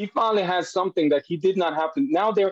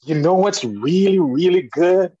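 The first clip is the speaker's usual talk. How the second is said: lightly voiced eager little boy, louder; son's voice; 3 semitones higher, 5 dB louder than usual. Dad retelling his son's eager question in a louder kid voice. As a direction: act out the son's excited question. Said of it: "he said".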